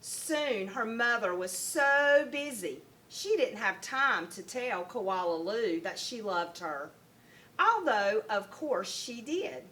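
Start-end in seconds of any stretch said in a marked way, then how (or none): none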